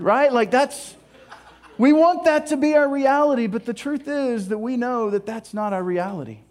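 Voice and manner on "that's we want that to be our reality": ordinary talk, nothing marked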